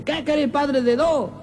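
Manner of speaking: high, slow annoyed retort; mock anger. Fed-up wife answering her husband back, mock angry. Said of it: "Y ella, enojada, le dice".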